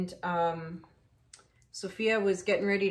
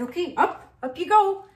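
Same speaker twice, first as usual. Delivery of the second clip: high, louder command to the dog; firm command; 10 semitones higher, 7 dB louder than usual. Retold or imitated. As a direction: order the dog up into the trunk. Ordering dog into car.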